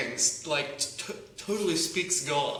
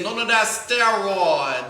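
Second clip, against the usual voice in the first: put-on loud, drawn-out Gullah accent; Troy; 5 semitones higher, 7 dB louder, slower than usual. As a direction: stretch it out slowly in a booming Gullah accent. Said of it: "that's the Gullah accent right there".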